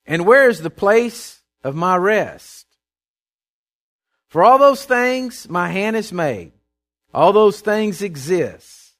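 loud, slow, weighty Bible reading; forceful preacher's delivery with drawn-out words; scripture proclamation. Acted. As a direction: declaim God's words, stretching them out. Reads God's words aloud.

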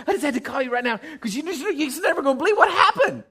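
fast, laughing, high excited voice; bursting to share the news; excited caller. Retold or imitated. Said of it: "You hear the excitement in the voice".

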